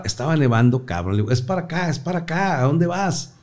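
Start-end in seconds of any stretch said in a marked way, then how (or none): 1.3-3.3 calling out. Retold or imitated. Calling wife going wrong way.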